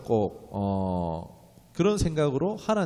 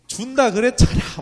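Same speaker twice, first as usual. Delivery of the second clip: much louder, higher snapping father; irritated shout; 11 semitones higher, 10 dB louder than usual. Retold or imitated. Father shouts at his nagging child, much louder. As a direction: yell at a nagging kid. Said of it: "한번 큰 소리가 나겠죠".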